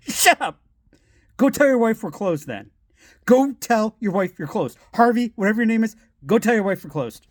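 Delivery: high-pitched mock irritation; rude dismissal. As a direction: imitate a rude man sending a customer away. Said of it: "Wow, that was rude".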